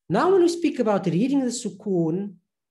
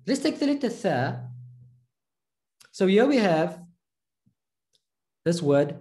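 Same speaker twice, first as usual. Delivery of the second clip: ordinary delivery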